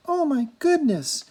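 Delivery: put-on bright, high girlish gasp of wonder. A narrator's reading. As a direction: exclaim it like a delighted young princess.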